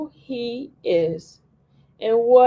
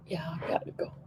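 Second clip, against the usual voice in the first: much softer, whispered goodbye; whispered sign-off; 2 semitones lower, 12 dB quieter, faster than usual. Real, not acted. Signing off to the viewers, in a soft whisper. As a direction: whisper your way out of the stream.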